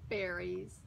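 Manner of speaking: ordinary delivery, nothing marked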